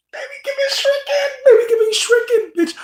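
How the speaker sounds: very high squealing laugh-cry; laughing mock wail. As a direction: squeal it, half laughing half crying. Laughing outrage at shrimp.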